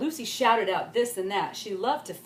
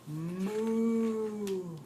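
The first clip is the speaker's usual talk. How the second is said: cow moo. Acted. Cow sound in story.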